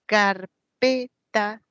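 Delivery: Carpeta very slow, split as CAR-PE-TA; syllable by syllable. Shown as a demonstration. Teacher counting three syllables, Carpeta slowly syllable by syllable. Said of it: "tres sílabas".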